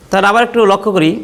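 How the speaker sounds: normal talking voice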